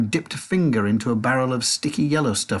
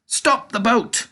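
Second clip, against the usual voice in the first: high, slow command, a bit less loud; shouted; 11 semitones higher, 3 dB louder, slower than usual. A narrator's reading. Narrator recalls Wonka's shout, high and slow. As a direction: call the stop again as a quote. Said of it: "When Mister Wonka shouted".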